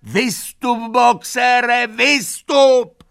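very high, loud calling; frantic shouting. A narrator's reading. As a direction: scream at him to get out. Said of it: "zvířata hned začala křičet".

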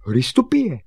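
high, loud call. Acted. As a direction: shout it out to someone outside. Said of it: "a volal".

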